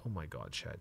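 ordinary talk

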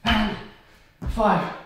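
usual speaking voice

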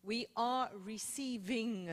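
"We are receiving" slow and deliberate, each word given weight; emphatic teaching. Shown as a demonstration. Preacher stresses a very important point to the congregation, slow and emphatic. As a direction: stretch out "are receiving" to drive the point home. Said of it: "this is very important".

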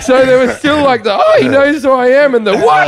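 high, laughing through the words. Real, not acted. Comic admitting he was thrilled at being recognized, high laughing delivery. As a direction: talk through real laughter. Laughing at his own story.

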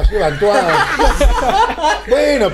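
usual speaking voice

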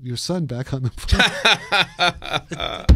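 slow, half-laughing mock robot voice; cold metallic voice. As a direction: play a computer politely asking, slowly. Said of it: "a cold metallic voice saying".

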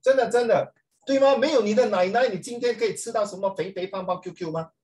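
loud, high, insistent appeal; rallying the students to applaud, loud and high; urgent pleading. Real, not acted. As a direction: push the class to clap now. Urges class to clap for grandmas.

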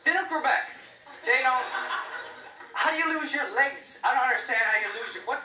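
high, loud disbelief; incredulous. Acted. Comic to the crowd about Six Flags riders, high disbelief. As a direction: act baffled that legs just come off.

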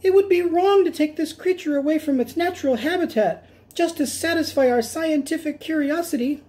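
high squeaky earnest objection; principled mouse. Acted. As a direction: argue nobly in a tiny mouse voice. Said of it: "replied Rosie Rodent".

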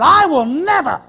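loud, high fervent vow; a loud, high passionate "I will never". Retold or imitated. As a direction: declare it with full conviction. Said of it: "passionate plea of the faithful".

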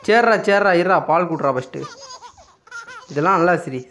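words through real laughter; laughing. Real, not acted. Laughing at baby's antics.

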